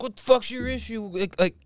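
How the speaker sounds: high-pitched mock yell; hammed-up angry complaint without full volume; imitated yelling. Storyteller voicing the guy demanding personal space, high mock yell. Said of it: "the guy that he's yelling at".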